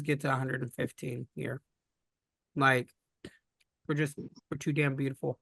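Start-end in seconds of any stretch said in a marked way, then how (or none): none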